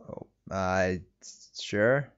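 ordinary talk

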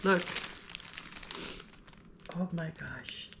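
hushed and overwhelmed, near tears; choked up. Real, not acted. Thanking friend Pam for a gift, choked up and hushed. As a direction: let the voice catch and fade with emotion. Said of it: "you're gonna make me cry".

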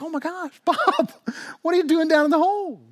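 high, loud, incredulous question with a laugh; astonished. Acted. Buddy finds his friend down a hole, astonished. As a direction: call down in amused disbelief.

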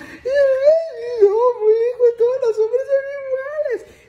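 exaggerated tearful falsetto lament; mock crying lady. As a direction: wail it like a heartbroken señora, hammed-up. Lady crying over her boyfriend.

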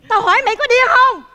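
fed-up comic outburst; exasperated scolding. Acted. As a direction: snap loudly at the fool. Bực mình mắng chàng rể khờ.

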